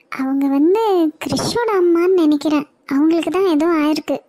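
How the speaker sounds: ordinary talk, nothing marked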